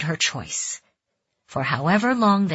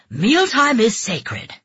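slow, loud, stern rebuke; scolding; 6 semitones higher, 5 dB louder, slower than usual. A narrator's reading. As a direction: scold him slowly and hard. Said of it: "Yoshi scolded".

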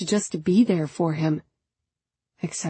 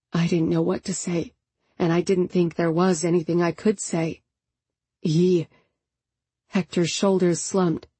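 ordinary delivery

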